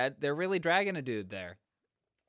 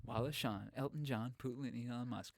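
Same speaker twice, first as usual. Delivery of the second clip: much softer, understated list of names; hushed rhyme recital; 10 dB quieter than usual. Pretend. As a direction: toss the rhyme off quietly, fading out. Same rhyme repeated, now hushed.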